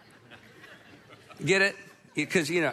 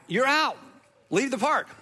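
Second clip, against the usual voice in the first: umpire ejecting; 4 semitones higher than usual. Retold or imitated. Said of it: "umpire throws our coach out".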